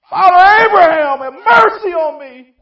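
high, very loud anguished shriek; shrieking rich man. Acted. Rich man in hell crying to Abraham, high shrieking shout. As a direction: scream for mercy from the flames. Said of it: "It literally means shrieked".

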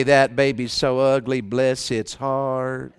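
low, soft Southern drawl, slowing at the end; hushed Southern sympathy, last words drawn out; Southern accent. Shown as a demonstration. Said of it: "say it with the right accent".

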